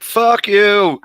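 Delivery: "Fuck you" yelled high and loud; angry New York greeting. Shown as a demonstration. Man showing the angry New York greeting, loud. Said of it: "two ways of going about it".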